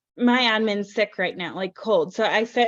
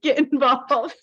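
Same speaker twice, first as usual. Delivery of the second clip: ordinary talk, nothing marked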